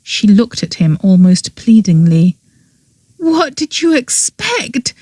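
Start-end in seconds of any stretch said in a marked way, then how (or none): 3.2-4.9 higher, a touch of tears and bitterness; half bitter, half crying; higher girl voice, bitter and near tears; bitter and tearful. A narrator's reading. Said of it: "half bitterly, half tearfully".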